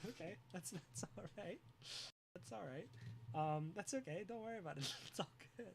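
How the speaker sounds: calm them softly; soft reassurance. Real, not acted.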